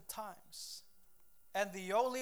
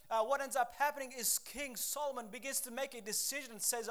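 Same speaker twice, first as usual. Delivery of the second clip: normal talking voice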